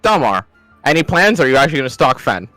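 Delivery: usual speaking voice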